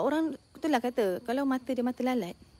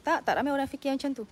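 ordinary talk

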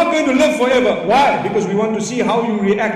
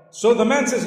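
usual speaking voice

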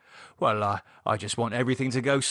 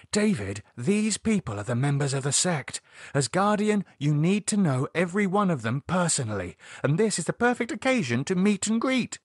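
higher-pitched, insistent woman's voice; insistent Lydia; 7 semitones higher than usual. Acted. Lydia urging David to meet the sect, insistent. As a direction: press the point, pitch climbing.

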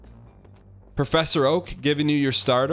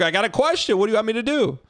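pitched way up, playful; high mock voice; 8 semitones higher, faster than usual. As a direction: squeak it out teasingly. Voicing game character, high pitch.

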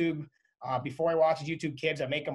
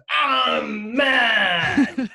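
imitating a mad toddler's loud, high wail; long drawn-out, hammed-up tantrum shout; angry toddler; 8 semitones higher, 10 dB louder than usual. Dad mimics his two-year-old son being refused a waffle, angry toddler shout. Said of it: "So he has anger issues".

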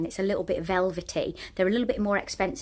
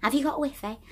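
put-on northern accent; 7 semitones higher than usual. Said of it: "they don't say it in a northern accent".

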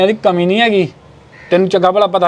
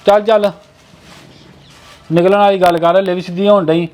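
higher, curt angry orders; 4 semitones higher than usual. Acted. Husband angrily orders wife to leave, angry. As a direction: snap at his wife to get moving. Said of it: "ਇੰਨੇ ਗੁੱਸੇ 'ਚ ਆ ਗਏ ਹੋ".

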